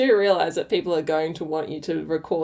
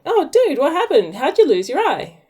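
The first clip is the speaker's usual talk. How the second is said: shocked mate; 9 semitones higher, 5 dB louder than usual. Acted. Mate's shocked question in joke.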